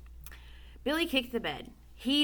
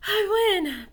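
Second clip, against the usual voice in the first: gasped; 9 semitones higher, 5 dB louder than usual. Acted. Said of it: "Billy gasped".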